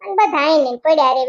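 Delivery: ordinary delivery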